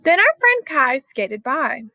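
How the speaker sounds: ordinary delivery, nothing marked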